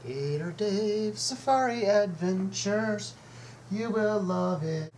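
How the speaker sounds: slow, high ad-announcer voice; promo announcer. Pretend. Vlogger plugging his brand as an announcer. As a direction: sell it like a TV promo.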